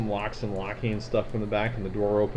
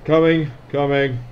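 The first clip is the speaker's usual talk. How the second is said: called through door; 3 semitones higher, 7 dB louder than usual. Acted. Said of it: "somebody from behind the door say".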